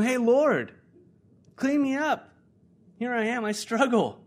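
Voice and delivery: high, loud confession; calling out to God. Acted. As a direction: cry out openly for help. Acts out sinners calling to God.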